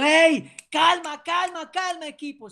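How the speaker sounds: loud, high call to calm the team; coach's shout. Shown as a demonstration. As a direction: shout it from the sideline to settle the players. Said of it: "usted puede comenzar así más duro".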